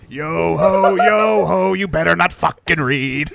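loud pirate snarl. Acted. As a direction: bark it out like a pirate. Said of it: "that was a pirate slave owner?".

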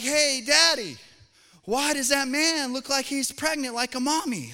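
put-on high, loud little kid blurting in public; loud child voice. Child asking daddy in the store, loud and high. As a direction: play a child shouting across the store. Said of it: "in a really loud voice".

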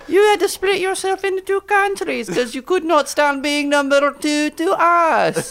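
high put-on Scottish taunt; Scottish accent. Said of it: "The Scottish and the Irish".

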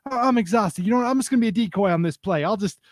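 high-pitched worn-out complaint, hammed up; Jackie Moon impression. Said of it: "Will Ferrell, Jackie Moon, he's just".

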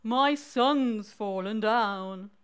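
slow, drawn-out mole voice; sad mole. Acted. Sad mole tells frog about the hole, slow mournful voice. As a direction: stretch the words out mournfully. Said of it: "mole who was looking quite sad".

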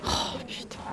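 whispered swear under the breath; whispered curse. Acted. Doctoral student whispering a curse at the question, whispered.

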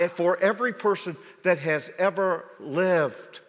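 high, loud fervent preaching; preaching at full force. Acted. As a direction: build it up like a revival. Preacher rises again.

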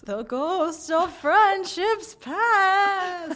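slow, high, eerie ghost voice; spooky voice. Pretend. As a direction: drag it out like a haunting ghost. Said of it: "Did I sound spooky?".

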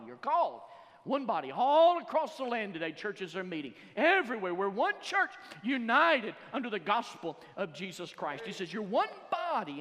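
loud, high, impassioned preacher; full-force preaching. Real, not acted. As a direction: proclaim it to the whole congregation.

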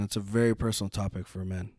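hushed, unvoiced aside; whisper. Real, not acted. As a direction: whisper it like a secret. Mock secret aside.

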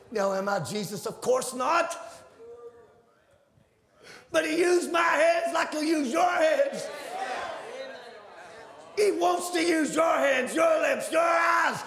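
high, loud preaching shout; shouting preacher. Acted. Preacher telling the church he'll use their hands, shouting.

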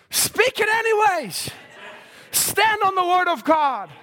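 high, drawn-out preaching cry; preacher's exhortation. Real, not acted. Preacher urging the congregation to stand firm, ringing exhortation. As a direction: call it out slow and high.

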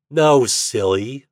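ordinary delivery

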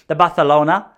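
"Barcelona" with a lisped c; lisped Castilian "Barcelona"; Spanish lisp. Shown as a demonstration. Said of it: "I chucked the lisp in there".

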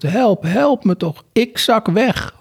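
slow, high-pitched cry for rescue; calling for help. A narrator's reading. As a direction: plead high, drawing the words out. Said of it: "hoorden ze roepen". (langgerekte, hoge hulpkreet)

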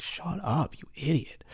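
sharp whispered scolding; dad hushing his son mid-deal, whispered scold; hushed scold. Retold or imitated. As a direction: hiss it under your breath so the seller can't hear. Dad hushing son during negotiation.

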